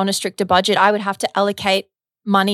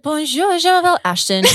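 mock French; 8 semitones higher than usual. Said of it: "I love when you say it".

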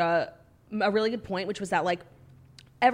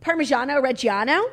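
raised, louder Italian "Parmigiano Reggiano"; showy Italian on "Parmigiano Reggiano"; Italian accent; 4 semitones higher, 5 dB louder than usual. Shown as a demonstration. Repeats the showy Italian order.

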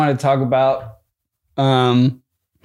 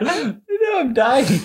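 laughing; 9 semitones higher, 3 dB quieter, much slower than usual. Real, not acted. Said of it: "I've never heard you laugh like that".

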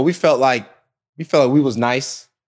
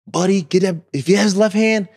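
higher, insistent teammate's call; urgent practice call; 6 semitones higher than usual. Retold or imitated. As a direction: call out orders to a defender. Acting out calls at practice.

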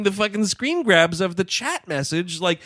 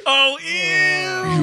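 grossed-out squeal; 14 semitones higher than usual. Real, not acted. Reacting to gross idea.

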